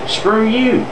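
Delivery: ordinary talk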